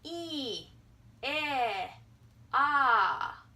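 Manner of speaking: "E, eh, ah" each held long, one at a time; drawn-out vowel demo. Shown as a demonstration. Teacher has viewers feel vowel height, drawn-out vowels. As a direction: step from E down to ah, vowel by vowel. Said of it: "Try it out".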